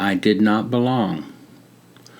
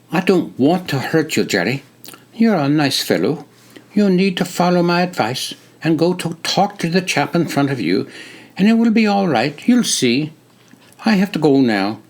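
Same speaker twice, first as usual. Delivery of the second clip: put-on higher British-like accent, quickening as he brushes it off; reassuring British-like accent; 7 semitones higher than usual. A narrator's reading. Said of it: "crisp, British-like accent".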